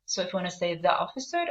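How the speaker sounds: ordinary talk, nothing marked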